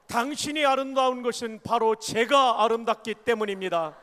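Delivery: loud, ringing line for the congregation to repeat; call for repetition. Shown as a demonstration. Preacher leading the congregation in a repeated line, proclaiming loudly. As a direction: proclaim it so the church can echo you. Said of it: "한 번 더 따라 하십니다".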